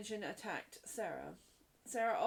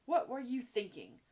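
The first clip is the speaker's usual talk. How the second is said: louder, demanding question; acted headmistress's indignation; stern demand; 2 semitones higher, 6 dB louder than usual. A narrator's reading. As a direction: demand an answer from the child. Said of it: "demanded Miss Minchin".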